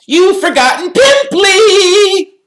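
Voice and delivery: very high, loud drawn-out jeer; taunting Peeves. A narrator's reading. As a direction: jeer in a piercing slow shriek. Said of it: "Peeves hissed in her ear".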